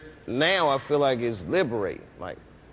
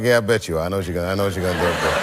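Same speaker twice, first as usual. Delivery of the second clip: knowing dad tease; 8 semitones lower, much faster than usual. Retold or imitated. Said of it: "I'm like".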